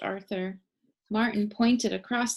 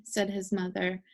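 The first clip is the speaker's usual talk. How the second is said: plain reading voice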